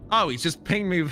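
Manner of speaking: usual speaking voice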